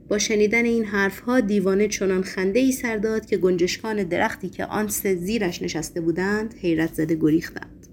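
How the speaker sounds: plain reading voice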